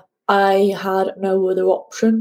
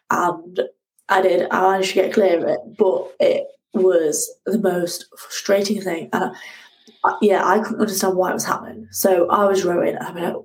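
ordinary delivery

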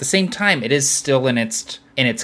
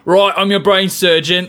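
higher-pitched mock Cockney; Cockney accent; 5 semitones higher, 3 dB louder than usual. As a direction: put on a Cockney brain surgeon. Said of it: "The Cockney".

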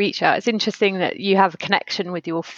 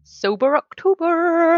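put-on slow, higher West Country accent, slipped in on a whim; 10 semitones higher, slower than usual. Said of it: "I said that in a West Country accent".

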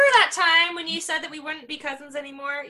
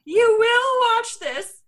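slow, higher, louder mock command; bossy cousin; 6 semitones higher, 4 dB louder, slower than usual. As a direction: play the bossy little cousin laying down the law. Cousin forcing him to watch.

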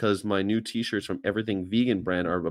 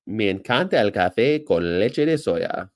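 put-on Spanish accent.

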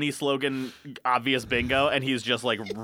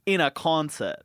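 slow, incredulous Aussie question; hammed-up Australian bafflement; put-on Australian accent; 3 dB louder than usual. Baffled about a live grizzly at a concert, put-on Australian accent. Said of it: "in an Australian accent".

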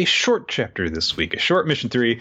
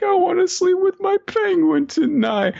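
very high, put-on child whine; hammed-up high kid voice; pleading little kid. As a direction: beg like an upset small child. Voices child Anya pleading at bedtime.

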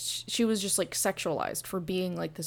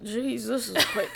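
laughing disbelief; 2 semitones higher than usual. Retold or imitated. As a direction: laugh through the line. Laughing reaction quote.

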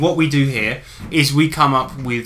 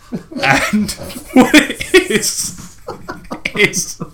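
laughing; 8 semitones higher, much slower than usual. Real, not acted. Giggling while pitching the joke.

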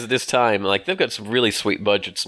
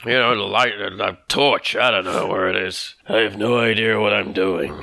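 mock Han Solo. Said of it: "He'd be like".

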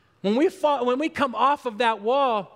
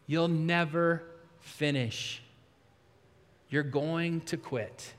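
much softer and lower, slow, a heavy discouraging murmur; discouraging inner voice; 7 semitones lower, 6 dB quieter, slower than usual. Acted. Preacher voicing the inner voice that says you'll quit, low slow murmur. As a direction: let it sink in low and slow like the doubt inside. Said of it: "the voice we hear more than any other voice".

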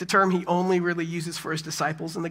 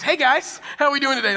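put-on higher, louder, breezy kid greeting; mock toddler's big hello, pitched up; imitating a chipper toddler; 8 semitones higher, 6 dB louder than usual. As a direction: stroll in and hail the room like a confident little kid. Toddler strolls in and greets parents.

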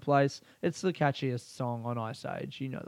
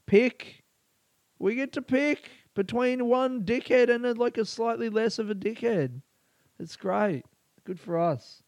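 sarcastic; 8 semitones higher than usual. Real, not acted. Podcaster mocks choosing between dickheads, sarcastic high voice. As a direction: sneer at the choice on offer. Sarcastic rant about election choices.

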